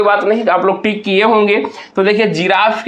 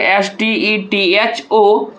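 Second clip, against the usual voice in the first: slow, letter-by-letter spelling; each letter separate and unhurried; much slower than usual. Shown as a demonstration. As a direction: spell the word out slowly for a class. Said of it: "किस प्रकार से तोड़ेंगे".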